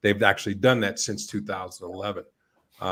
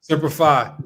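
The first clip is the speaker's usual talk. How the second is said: higher, louder military salute; loud gung-ho soldier; 6 semitones higher, 5 dB louder than usual. Pretend. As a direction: shout it like a proud marine. Marine banter, soldier salute.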